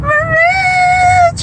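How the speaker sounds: very high crying shriek of I'm rich; joyful screech. Real, not acted. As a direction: screech it out, overjoyed.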